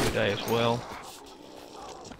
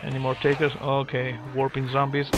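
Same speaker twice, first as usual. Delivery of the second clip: ordinary delivery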